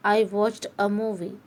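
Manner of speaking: very slow, each word spelled out for learners; slow example sentence. Shown as a demonstration. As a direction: read it out word by word like a teacher. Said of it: "क्या आया?". (धीमा उदाहरण वाक्य, हर शब्द अलग)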